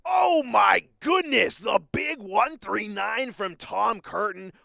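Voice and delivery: huge drawn-out, high commentator shout; too loud. Real, not acted. Commentator calls the big one three nine, roaring excitement. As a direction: roar the big moment, slow and high. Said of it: "I'm getting too loud on the microphone".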